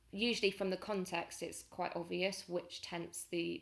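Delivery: ordinary talk, nothing marked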